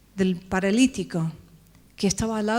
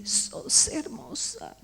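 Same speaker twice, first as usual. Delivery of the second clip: high, soft, tearful near-whisper; crying tenderly; 6 semitones higher, 5 dB quieter, much slower than usual. Retold or imitated. The preacher, weeping, tells her she is beautiful. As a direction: tell her through tears that she is beautiful. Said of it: "pero llorando".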